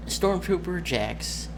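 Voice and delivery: normal talking voice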